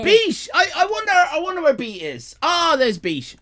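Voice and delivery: high, loud call to a friend; excited greeting. Real, not acted. Calling out to a viewer.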